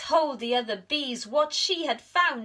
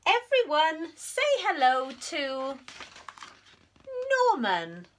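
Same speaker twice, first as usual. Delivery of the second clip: higher, louder royal announcement; queen announcing; 7 semitones higher, 3 dB louder than usual. Acted. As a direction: present the new pupil proudly. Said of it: "said the queen".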